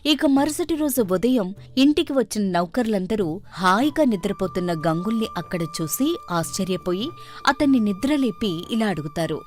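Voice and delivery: ordinary delivery, nothing marked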